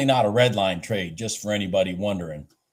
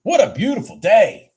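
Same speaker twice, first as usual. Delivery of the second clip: excited; 11 semitones higher, 7 dB louder than usual. Real, not acted. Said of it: "I'm up sixteen hundred".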